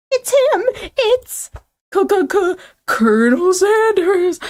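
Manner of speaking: very high, stammering fangirl; hammed-up high-pitched awe, last words drawn out; starstruck stammer. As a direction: stammer the big reveal way up high. Character stammers at Colonel's arrival.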